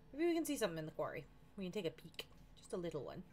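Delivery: ordinary delivery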